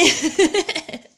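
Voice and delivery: high, loud burst of laughter; real amused laugh. Real, not acted. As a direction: laugh out loud at your own joke. Jokes about getting told off.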